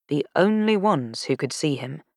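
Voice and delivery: ordinary delivery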